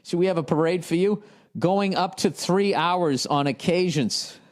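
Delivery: mocking sarcastic. Mocking the letter writer.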